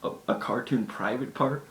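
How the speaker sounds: normal talking voice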